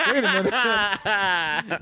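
slow, drawn-out Columbo voice; a slow, light take on Columbo's catchphrase. Acted. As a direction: play the rumpled detective, slow and puzzled. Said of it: "a real Columbo moment for [name]".

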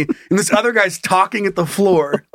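normal talking voice

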